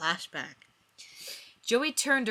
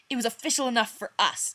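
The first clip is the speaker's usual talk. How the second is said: arguing; 5 semitones higher, 6 dB louder, faster than usual. Acted. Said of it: "Joey argued".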